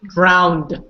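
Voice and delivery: "drowned" with an added d on the end, as the base word; wrong DROWNED. Shown as a demonstration. Teacher shows how people say it, mispronounced drowned. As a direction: say drowned with a clear final "nd". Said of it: "This is how people say it".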